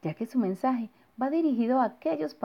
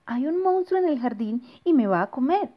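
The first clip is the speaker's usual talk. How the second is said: put-on alarmed child; 6 semitones higher, 4 dB louder than usual. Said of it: "dijo Bernardo".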